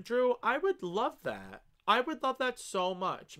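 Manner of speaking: high, loud, drawn-out enthusiasm; thrilled acceptance. Real, not acted. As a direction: gush slowly at a welcome invitation. Thrilled by a viewer's invitation to play.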